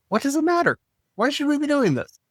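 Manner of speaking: high-pitched mock questioning; mock challenge. Host voices a doubter questioning the episode's topic, mock challenge. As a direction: pitch it way up like an incredulous objector.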